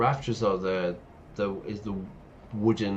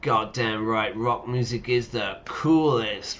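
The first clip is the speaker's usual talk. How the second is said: ordinary talk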